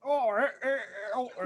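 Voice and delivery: high, pained attempt at yeehaw British; yeehaw British accent. Shown as a demonstration. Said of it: "you sound like you're in pain".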